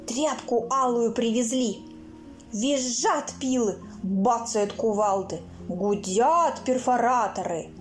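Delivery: ordinary delivery, nothing marked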